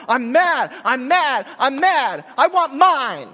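loud, high childish mock anger; mock tantrum. Mocking a spoiled child's tantrum, loud and childish. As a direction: stamp it out like a toddler. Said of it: "it's the end of the world".

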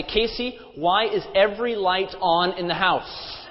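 slow, pointed mock complaint; sarcastic, rude. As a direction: imitate an exasperated husband's rude question. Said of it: "sarcastically or rudely turn to my wife".

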